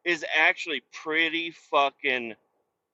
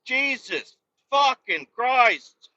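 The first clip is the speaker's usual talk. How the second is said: slow, drawn-out groan of a curse; real weary exasperation; exasperated curse; 7 semitones higher, much slower than usual. Real, not acted. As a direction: sigh it out in disgust. Exasperated at driving.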